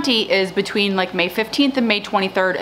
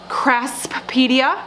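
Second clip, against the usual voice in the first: slow, careful pronunciation; 4 semitones higher, much slower than usual. Real, not acted. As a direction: draw out Craspedia slowly, part by part. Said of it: "I had to say that slow".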